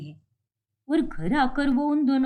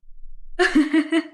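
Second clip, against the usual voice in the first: mocking laugh; 4 semitones higher than usual. Neighbour laughing at the daughters-in-law, mocking laugh.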